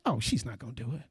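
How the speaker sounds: dismissive teacher. Retold or imitated. Mocking teachers writing kids off.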